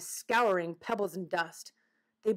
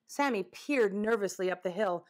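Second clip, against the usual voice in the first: usual speaking voice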